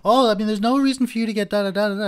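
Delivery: high, loud mock cashier voice; shrill it out as the cashier; imitating the cashier. Shown as a demonstration. Teacher mimicking a cashier in a crude retelling, high and loud. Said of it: "I'd be trying to imitate her".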